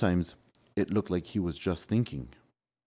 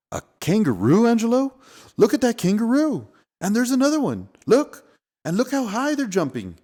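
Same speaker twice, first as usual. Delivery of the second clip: amazed; 14 semitones higher, 6 dB louder than usual. Acted. Amazed at kangaroos.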